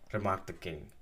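usual speaking voice

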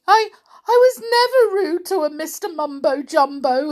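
high, loud, stammering protest; frightened wail. A narrator's reading. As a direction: wail like a terrified toy bear. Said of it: "he wailed".

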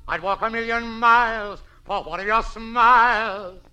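high, loud, slow crooning impression; [name] impression. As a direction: belt it like a Jolson-style showman. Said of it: "who does that sound like".

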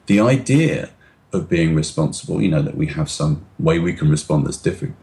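ordinary talk, nothing marked

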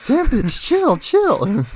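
high, loud playful protest; mock exasperated yell. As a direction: squeal it in mock outrage at a nag. Joins in acting out the retort.

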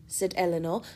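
ordinary delivery

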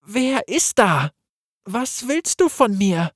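put-on high, slow, scared young man's voice; fearful questions. Gareth asks the voice in his head, high and afraid. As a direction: ask the unseen voice in fear. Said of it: "fragte er angsterfüllt".

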